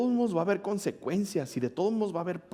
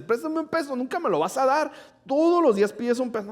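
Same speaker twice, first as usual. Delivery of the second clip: high, slightly louder nagging imitation; mock whiny girl; 10 semitones higher, 4 dB louder than usual. Girl nagging narrator every day, high mock whine. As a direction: mimic a pestering schoolgirl.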